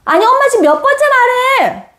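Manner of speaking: high-pitched exasperated calling over noise; yelling mom. Acted. Mom shouting at eldest over TV and sibling, high scolding yell. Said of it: "엄마는 내 첫째에게 소리 지-, 지르고".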